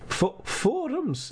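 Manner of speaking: put-on Scottish accent, slow and high. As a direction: savour it in a Scottish lilt. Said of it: "he's Scottish".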